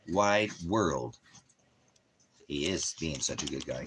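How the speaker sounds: ordinary talk, nothing marked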